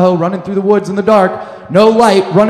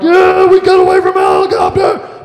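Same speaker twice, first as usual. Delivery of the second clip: triumphant yell; 11 semitones higher, 4 dB louder than usual. Retold or imitated. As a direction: scream it like you just won everything. Celebrating in the van.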